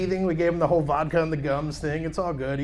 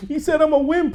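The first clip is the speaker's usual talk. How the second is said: small son voice; 9 semitones higher, 3 dB louder than usual. Retold or imitated. Son's voice telling about bully.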